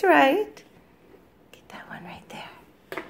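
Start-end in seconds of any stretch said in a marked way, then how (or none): 1.5-3.0 soft whisper. Real, not acted. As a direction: whisper the hint. Whispered coaching to toddler.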